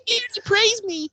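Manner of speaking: put-on high, squeaky little-kid voice; childish voice. Cohost mocking Wes as a proud little boy. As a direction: play a kid beaming about dad. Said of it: "Daddy's proud of me".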